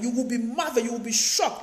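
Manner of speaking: ordinary talk, nothing marked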